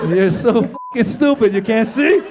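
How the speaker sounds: slow, drawn-out mock scorn; mock disbelief. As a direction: act out a look that says "you're an idiot". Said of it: "He looked at me like I'm so stupid".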